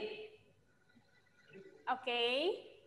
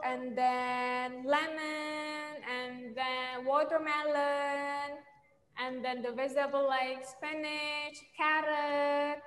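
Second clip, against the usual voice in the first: slow teaching list; 3 dB quieter, slower than usual. Shown as a demonstration. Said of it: "teacher listing words slowly for kids".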